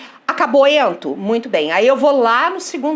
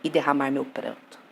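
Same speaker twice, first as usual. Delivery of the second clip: poem recitation; 5 semitones lower than usual. Acted. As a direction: recite the sonnet with weighted pauses. Recites a sonnet to the class. (declamação pausada de poema)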